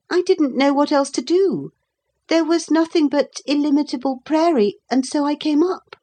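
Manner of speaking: put-on high-pitched small boy's voice; explaining boy. A narrator's reading. Boy questioned by the captain, explaining.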